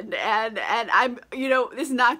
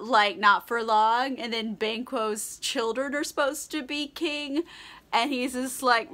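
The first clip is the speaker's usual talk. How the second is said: ordinary delivery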